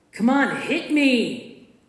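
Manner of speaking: loud goading yell; taunting shout. A narrator's reading. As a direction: dare her to hit you. Attacker taunts narrator to fight.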